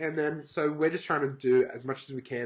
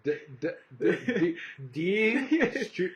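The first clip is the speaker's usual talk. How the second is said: stammering over each letter, high; reading aloud haltingly; 4 semitones higher, slower than usual. Pretend. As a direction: sound it out, stuck on the d. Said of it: "I struggle to read now".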